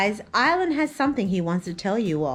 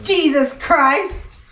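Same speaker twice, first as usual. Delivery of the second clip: exasperated; 6 semitones higher, 6 dB louder, much slower than usual. Real, not acted. Exasperated with the baby.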